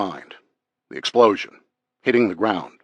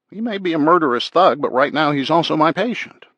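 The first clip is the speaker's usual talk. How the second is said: higher, firm doctor's voice; insistent doctor; 7 semitones higher, 4 dB louder than usual. Acted. Doctor defending a wounded prisoner as patient, insistent and higher. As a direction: argue as the doctor, pitched well up.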